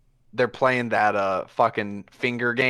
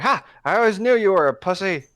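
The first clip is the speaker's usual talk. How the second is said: fast, higher, louder taunt; mocking jeer; 9 semitones higher, 5 dB louder, faster than usual. Gunman taunting Zenith as he goes in, fast jeering. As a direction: sneer and spit it out quickly.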